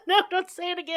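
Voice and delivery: pleading through laughter; laughing. Real, not acted. Laughing protest at repeated slang.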